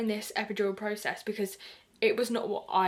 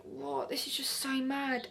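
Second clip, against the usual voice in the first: higher, slowed-down amazement; retold disbelief; 4 semitones higher, 4 dB quieter, slower than usual. Patient reacting to the epidural set-up, stunned.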